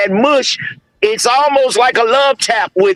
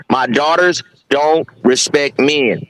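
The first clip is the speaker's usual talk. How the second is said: put-on lower, slow older man's voice; 6 semitones lower, slower than usual. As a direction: imitate an older man warning slowly, pitched down. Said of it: "that video of that older man".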